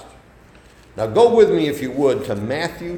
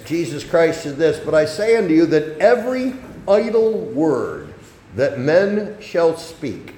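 loud, weighty Bible reading; scripture reading; 3 semitones higher, 6 dB louder than usual. Real, not acted. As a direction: proclaim the verse, drawing out key words. Reads scripture aloud to congregation.

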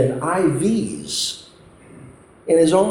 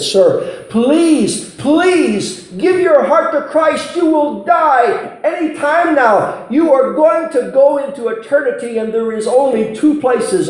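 desperate pleading; 11 semitones higher than usual. Retold or imitated. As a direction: beg a dying man to believe. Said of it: "I pleaded with this man".

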